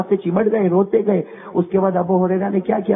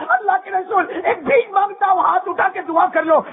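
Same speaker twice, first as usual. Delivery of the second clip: climbing higher and more urgent with each repeated line; impassioned preaching; 10 semitones higher than usual. Acted. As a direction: plead with the crowd, voice rising to a cry. Fervent preaching build to plea.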